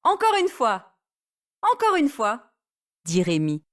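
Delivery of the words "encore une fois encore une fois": high eager repeat; eager begging. Acted. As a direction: beg for another go. Rémi begs again. (supplie, aigu et enthousiaste)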